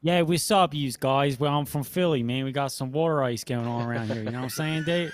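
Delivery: hammed-up Philly street greeting; Philly accent. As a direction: put on a thick Philadelphia accent. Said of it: "is that how you talk in Philly".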